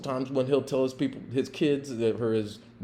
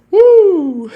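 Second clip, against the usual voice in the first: joyful whoop; 15 dB louder than usual. Real, not acted. Happy after leaving.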